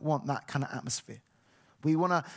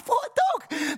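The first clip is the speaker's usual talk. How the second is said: much higher, loud, triumphant cry; excited announcement; 4 dB louder than usual. Retold or imitated. Dad announcing new dog to his kids, excited shout. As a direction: blurt out the news with glee.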